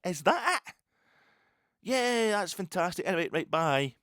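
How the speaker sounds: high, loud mock-deadpan disappointment; sarcastic disbelief. Fan at the stadium mocking the flat ending, high sarcastic disbelief. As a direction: sarcastically shrug off a letdown. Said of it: "And I'm standing there going".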